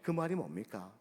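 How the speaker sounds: normal talking voice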